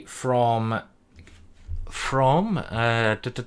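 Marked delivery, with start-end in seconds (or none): none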